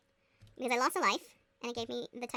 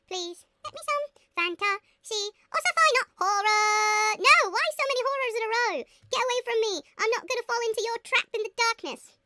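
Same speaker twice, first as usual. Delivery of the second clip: high, loud mock desperation; hammed-up outcry against a trap; theatrical protest; 7 semitones higher, 8 dB louder than usual. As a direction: wail it like a melodramatic plea. Mock pleading against horror books.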